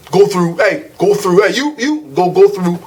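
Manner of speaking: lightly voiced curt agent waving people on; mock bossy customs agent. Traveler mocking the customs agent barking at passengers. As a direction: brusquely order the line through. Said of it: "it's lame to be all like".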